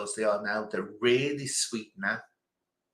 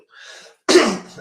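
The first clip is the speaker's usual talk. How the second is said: a sharp, loud clearing of the throat; 11 semitones higher, 11 dB louder than usual. Real, not acted. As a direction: clear the throat hard before speaking. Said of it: "Excuse me, my throat".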